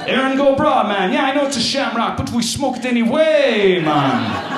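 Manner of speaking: high, hammed-up Jamaican accent posing as Irish; botched Irish-Jamaican accent. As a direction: draw out the last words in a mock Jamaican lilt. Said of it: "turns into a Jamaican accent".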